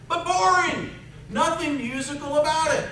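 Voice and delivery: high, loud put-down; dismissive. Real, not acted. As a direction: dismiss it with a scoff. Mocking the flat playing.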